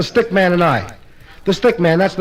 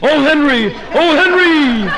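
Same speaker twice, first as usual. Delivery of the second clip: hollering a name; 5 semitones higher, slower than usual. Retold or imitated. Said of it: "she was hollering".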